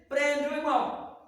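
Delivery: ordinary delivery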